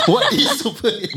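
amused, high, laughing disbelief; laughing question. Real, not acted. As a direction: laugh it out as you ask.

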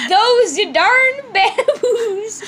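slow, loud, high through laughter; can't get the words out for laughing. Real, not acted. Reading a slip of paper while cracking up.

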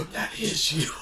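high gasp of recognition; gasp, then marvel in a lifted voice; awestruck. Retold or imitated. Fan realises his friend is the ride's voice, awestruck. Retold astonished reaction, chills.

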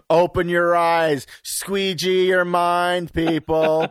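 slow, drawn-out slogan; stretched-out mock truth-teller proclamation; mock rallying cry. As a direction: preach it to the masses as a joke. Mock conspiracy slogan about windows.

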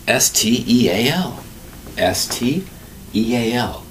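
slow, deliberate letter-by-letter spelling. Shown as a demonstration. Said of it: "spelling the word slowly".

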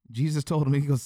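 ordinary talk

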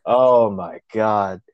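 slow, drawn-out disbelief; retold dismay. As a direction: stretch it out, appalled. His dismayed reaction to Eric's answer.

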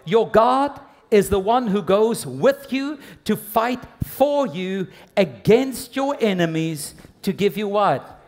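high, forceful sermon delivery; preaching at full force. Acted. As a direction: drive each phrase home to the congregation.

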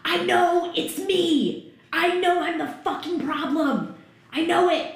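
loud, high, anguished yell; screaming back. Retold or imitated. Wife screaming at husband during a fight. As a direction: scream it at him slowly, desperate. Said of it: "screaming back at him".

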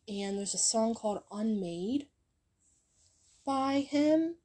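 normal talking voice

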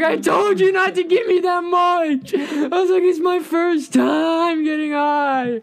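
very high, loud, slow whine; panicked whine. Retold or imitated. First-timer scolding friend after the huge rip, high panicked whine.

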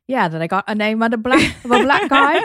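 half-hearted British accent impression of the airport worker; mild British accent, said while laughing; bad British accent. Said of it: "my accent's really bad".

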